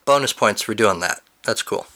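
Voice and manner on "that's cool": usual speaking voice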